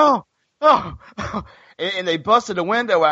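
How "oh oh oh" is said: high, breathy fake moans; mock moaning. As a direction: moan it like a hammy act. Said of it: "she was making noise like".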